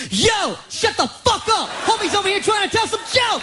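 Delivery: high-pitched indignant yell; hammed-up outraged shouting. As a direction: holler at a noisy room. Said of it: "best thing anyone's ever yelled".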